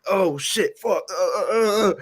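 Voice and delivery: panicked. Retold or imitated. Batman panicking in chase.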